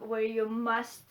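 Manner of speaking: normal talking voice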